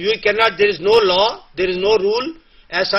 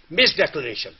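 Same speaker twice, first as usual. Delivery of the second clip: normal talking voice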